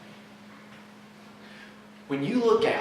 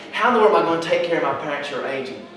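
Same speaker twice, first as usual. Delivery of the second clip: raised, mounting mock despair; freaking out; 4 semitones higher, 8 dB louder than usual. Churchgoer fretting over parents, future and debt, freaking out. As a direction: build from worry to loud hopelessness. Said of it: "you're trying to freak out and wonder".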